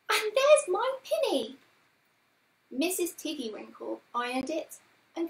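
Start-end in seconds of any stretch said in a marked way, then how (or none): 0.1-1.6 put-on very high, loud child character's exclamation; excited cry. A narrator's reading. Lucy finding her pinny in the washing, excited cry. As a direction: pipe up high and loud in delighted recognition. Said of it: "cried Lucy".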